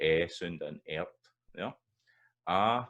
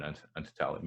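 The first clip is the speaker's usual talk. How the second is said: usual speaking voice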